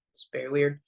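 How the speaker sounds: ordinary talk, nothing marked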